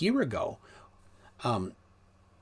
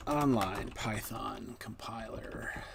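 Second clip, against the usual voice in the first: muttered to self; 6 dB quieter, slower than usual. Real, not acted. Muttering while typing search.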